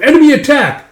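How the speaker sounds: high, loud battle alarm; alarm shout. Retold or imitated. Warning the village of raiders, alarm shout. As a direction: cry out a warning to everyone.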